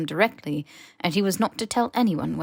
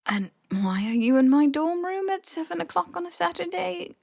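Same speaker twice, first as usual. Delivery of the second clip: groggy exasperation; 11 semitones higher than usual. Acted. Harry annoyed at early elf.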